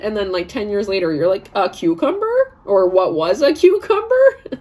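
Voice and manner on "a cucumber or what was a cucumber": high, slow, drawn-out disbelief; higher, slower and louder, hammed-up surprise; mock disbelief. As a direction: stare at the forgotten vegetable, baffled. Finding forgotten cucumber, high slow.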